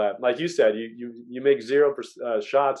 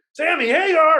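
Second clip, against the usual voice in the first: high, loud starstruck yell; mock fan freak-out, pitched way up; going nuts; 6 dB louder than usual. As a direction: act out blurting it like an overexcited fan. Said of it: "I went nuts".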